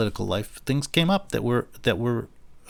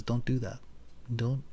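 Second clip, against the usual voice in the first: quiet inner voice; 12 dB quieter than usual. Pretend. Conscience cautions him quietly, much softer. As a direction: murmur it like a conscience inside you.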